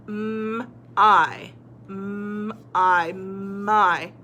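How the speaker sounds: held M, then long I, blended into MY; sounding out. Shown as a demonstration. Teacher trying Y as I, sounded out. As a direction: sound out M and I slowly, then join them. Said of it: "let's try the Y saying I".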